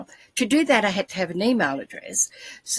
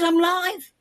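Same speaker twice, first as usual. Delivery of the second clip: sheepish confession; 10 semitones higher than usual. Retold or imitated. Telling husband she went live.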